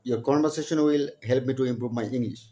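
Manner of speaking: ordinary talk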